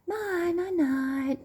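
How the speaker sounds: put-on high toddler voice saying goodnight; small kid's high bedtime reply; two-year-old daughter. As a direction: imitate a little child obediently agreeing. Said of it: "She just said".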